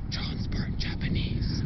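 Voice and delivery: slow, low menacing growl; growling dad. Retold or imitated. Dad creeping up to the son in a slow mock growl. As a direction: hiss it out slowly like a villain. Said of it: "he's growling".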